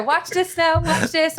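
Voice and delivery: high, teasing nag; a hint of a pestering dad; imitating dad. Dad pushing Botched clips on daughter, high mock nagging. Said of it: "My dad used to go".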